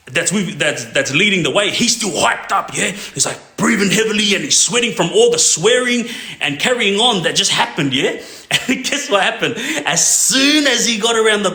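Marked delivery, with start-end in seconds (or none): none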